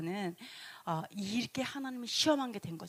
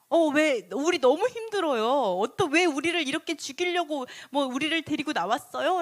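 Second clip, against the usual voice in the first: higher-pitched acted whining protest; louder, pleading grumble; resentful complaining; 4 semitones higher, 4 dB louder than usual. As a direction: complain like worn-out, aggrieved people. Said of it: "원망하고 불평했는데".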